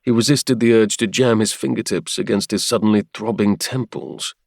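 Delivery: usual speaking voice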